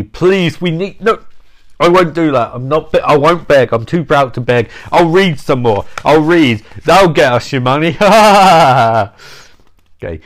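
normal talking voice

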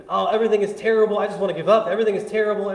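whiny, high, fast and loud mock moan; mock complaining. Pastor mocking his own complaining to the congregation, hammed-up whine. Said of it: "and I gotta complain".

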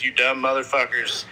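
normal talking voice

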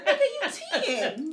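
slow, high and loud through laughter; laughing disbelief. Real, not acted. Mother laughing at her ten-year-old's stress, real laughter. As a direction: say it while still cracking up.

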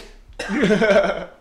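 loud laugh breaking the tension; embarrassed laugh. Real, not acted. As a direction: burst out laughing at yourself. Laughs after emotional retelling.

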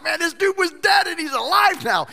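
high, fast, excited shouting. Pretend. Crowd cheering Lazarus the rock star, high excited shout. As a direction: hype it up like fans of a rock star.

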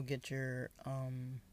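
words around a sip of water; talking between gulps; while sipping a drink. Real, not acted. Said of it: "I'm drinking a lot of water right now".

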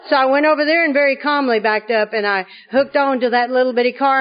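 ordinary talk, nothing marked

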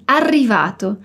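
arrivato with a strong trilled rr, said slowly; rolled double R. Shown as a demonstration. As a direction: roll the rr hard in arrivato. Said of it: "se concentra na pronúncia do R".

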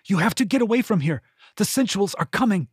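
fast, high urgent warning. A narrator's reading. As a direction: rush the words out in alarm. Urgent warning, others approaching.

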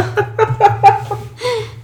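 sudden high laugh bursting out; loud burst of laughter. Real, not acted. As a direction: laugh out loud, caught off guard. Reacting to a cousin being named.

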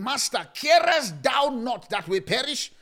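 loud, high, slow panicked plea; panicked cry. Acted. Disciples shouting to the sleeping master, loud and desperate. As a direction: cry out to the master over the storm.

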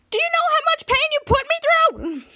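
very high, anguished accusation; acted outrage. Chopper zapping Ray over the phone, high-pitched mock outrage. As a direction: wail it in mock fury.

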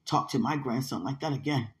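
firm scolding, stressed then dropping low; low, measured reprimand; retold stern warning. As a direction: retell a grandmother's firm warning. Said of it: "because she said to me".

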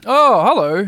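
loud, high happy greeting; joyfully exclaimed hello; joyful shout. A narrator's reading. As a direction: call out in glad surprise. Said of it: "he shouted joyfully".

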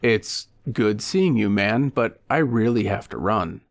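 plain reading voice

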